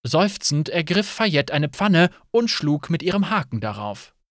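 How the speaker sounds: ordinary delivery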